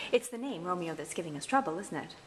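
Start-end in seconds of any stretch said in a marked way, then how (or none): none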